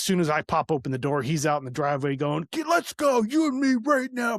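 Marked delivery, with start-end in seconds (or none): 2.5-4.4 high-pitched imitation of a man spoiling for a fight; mock angry challenge. Brother calling the speaker out in the driveway, mock angry challenge. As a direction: call him out, pitched way up.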